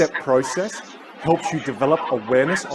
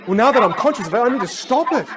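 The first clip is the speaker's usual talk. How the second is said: higher-pitched, mildly excited retelling; acted realization; 9 semitones higher, 5 dB louder than usual. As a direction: voice his eager new resolve, lightly. Acting out Stuart's sudden realization.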